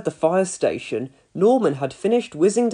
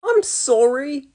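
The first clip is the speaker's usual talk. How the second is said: put-on sheepish boy; 8 semitones higher, slower than usual. A narrator's reading. Boy apologises.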